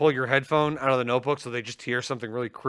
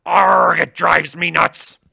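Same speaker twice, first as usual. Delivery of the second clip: pirate voice; 4 semitones higher, 9 dB louder, much slower than usual. Retold or imitated. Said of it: "And the pirate goes".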